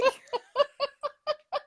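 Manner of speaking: high-pitched burst of laughter; laughing. Real, not acted. Shared laugh about resisted archetypes.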